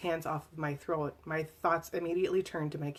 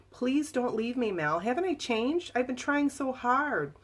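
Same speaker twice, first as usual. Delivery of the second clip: higher, slow begging plea; pleading sadly; 7 semitones higher than usual. Retold or imitated. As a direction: beg her not to go, voice lifted and sad. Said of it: "His voice was filled with compassion and sadness".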